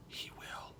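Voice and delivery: a breathy whisper, no voice; whispered comeback; whispered punchline. Retold or imitated. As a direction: drop to a whisper for the last word. Punchline reply to the woman.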